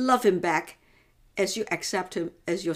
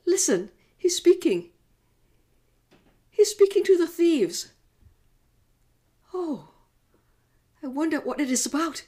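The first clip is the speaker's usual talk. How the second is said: higher, louder, urgent outburst; stirred, anxious mother; 9 semitones higher, 5 dB louder than usual. Acted. Mother tells John he's speaking, high urgent excitement. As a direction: call John's attention with sudden urgency. Mary hears Jesus speak.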